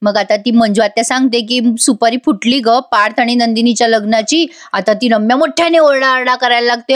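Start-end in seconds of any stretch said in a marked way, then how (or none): none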